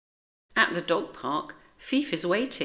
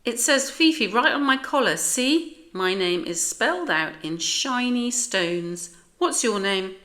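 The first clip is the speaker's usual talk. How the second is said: higher, fast, chirpy little dog; small dog Fifi; 6 semitones higher, 5 dB louder than usual. A narrator's reading. Fifi shows off her sparkly collar, high and chatty. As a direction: show off your collar proudly. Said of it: "says the small dog".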